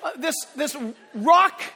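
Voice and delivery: thrilled at the imagined scene; excited. Real, not acted. Excited imagining rock concert.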